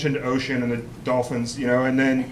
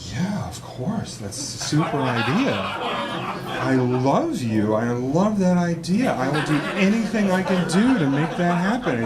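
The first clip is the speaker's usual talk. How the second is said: gushing agreement. Retold or imitated. Gushing drugged agreement to girlfriend.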